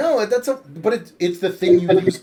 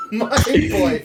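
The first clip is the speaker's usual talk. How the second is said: high, slow, laughing repeat of the quote; laughing echo; 11 semitones higher, 4 dB louder, slower than usual. Real, not acted. Echoing his friend's joke while laughing, high and slow. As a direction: giggle it out way up high. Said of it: "laughing echo of quote".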